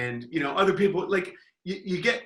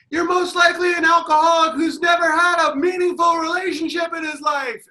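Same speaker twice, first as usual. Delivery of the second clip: high, loud jeering yell; heckling shout; 13 semitones higher, 6 dB louder than usual. Retold or imitated. Heckler yelling at the third baseman, high shout. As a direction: hurl the insult at the player.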